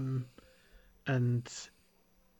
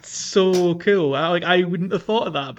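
higher and louder, genuinely impressed; excited; 6 semitones higher, 10 dB louder, slower than usual. Real, not acted. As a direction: gush about how cool it is. Amazed by a clever solution.

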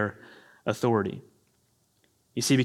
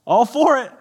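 much higher, cheering the preacher on; amen-corner enthusiasm, higher and louder; cheering along; 10 semitones higher, 5 dB louder than usual. Retold or imitated. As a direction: egg on the preacher from the pews. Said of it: "I'm like".

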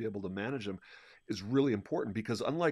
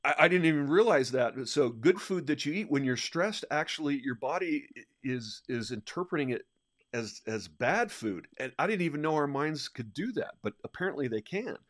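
higher and louder with wonder; amazed and eager; excited; 6 semitones higher, 6 dB louder than usual. Real, not acted. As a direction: marvel at the fact. Excited about metabolism surprise.

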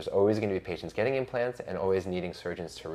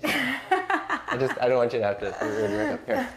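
laughing; 5 semitones higher, 5 dB louder than usual. Real, not acted. Handing tissue, laughing.

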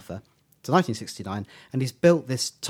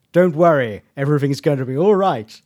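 loud, booming, raised reassurance; big theatrical Doctor voice; Tom Baker; 3 semitones higher, 9 dB louder than usual. Retold or imitated. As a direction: proclaim it grandly in an actor impersonation. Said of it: "amazing Tom Baker voice".

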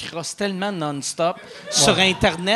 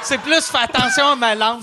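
fussy partner voice; 4 semitones higher, 5 dB louder than usual. Retold or imitated. Mocking a fussy partner's warning.

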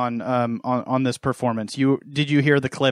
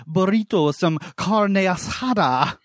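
put-on overdone Spanish accent with rolled R's; mock Spanish accent; 4 semitones higher than usual. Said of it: "roll all of my R's".

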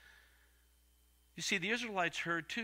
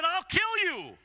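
high, loud mock threat; hammed-up dad's warning; threatening father; 13 semitones higher, 5 dB louder, slower than usual. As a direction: belt it out as a fierce threat.

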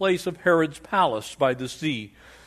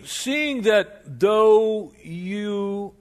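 flattering orator; 6 semitones higher, much slower than usual. Acted. Preacher voicing flattering lawyer.

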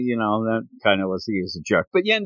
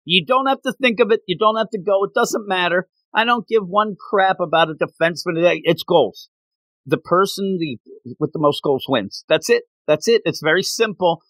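higher, fired-up mock rant; imitating a ranting coach; 6 semitones higher than usual. Coach lecturing his soccer team, higher and worked up. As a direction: play the zealous coach preaching goals. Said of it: "And he says".

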